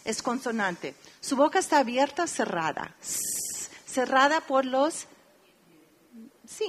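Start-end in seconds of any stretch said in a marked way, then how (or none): 3.0-3.7 voiceless "S" with closed lips, held; hissed s sound. Shown as a demonstration. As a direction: hiss the "S" as a demo. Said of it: "Di el sonido s". (sonido "s" sordo y sostenido)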